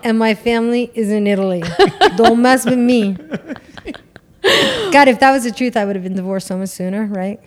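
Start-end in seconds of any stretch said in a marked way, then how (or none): 0.0-3.2 mock Italian-American tough guy.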